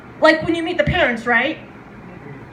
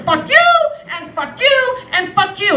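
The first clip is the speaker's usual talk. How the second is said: cussing out the world; 2 semitones higher, 3 dB louder than usual. Acted. Woman finding gray hairs and cursing the world, mock fury. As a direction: hurl each curse out in comic defiance. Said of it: "you're cussing out the world".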